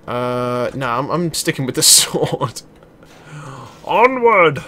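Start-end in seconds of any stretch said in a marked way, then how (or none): 3.8-4.6 loud, higher rallying shout; battle cry. Pretend. Gamer leading his team into battle, battle cry. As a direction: cry out to lead a charge.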